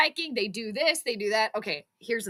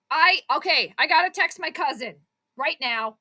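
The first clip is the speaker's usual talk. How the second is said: ordinary delivery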